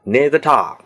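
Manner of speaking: London "near the tower", with "tower" shrunk to one long "tah"; London accent. Shown as a demonstration. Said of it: "他们会念成什么呢". (伦敦口音，tower读成单音节tah)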